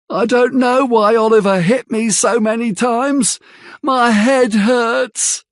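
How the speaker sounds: high, whiny complaint, slowing at the end; Noah, whining. Acted. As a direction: play the wounded victim. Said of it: "says Noah".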